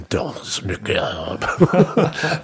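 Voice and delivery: Danse Macabre drawn out very slowly with a French lilt; hammed-up French accent. Shown as a demonstration. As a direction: lay on a mock French accent, slow and laughing. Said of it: "And once more with a French accent".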